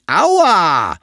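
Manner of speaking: loud, high, furious yell; angry cry. A narrator's reading. All the animals shouting at the fire toad, angry cry. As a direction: yell it out in anger. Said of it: "Alle Tiere riefen zornig".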